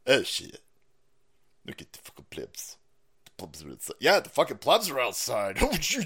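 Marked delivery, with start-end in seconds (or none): 0.1-4.0 lower, softer whispered sneer; mock BlackRock insider. Imitating BlackRock dudes sneering at the plebs, hushed. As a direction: hush it like a smug insider. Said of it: "dudes inside BlackRock going".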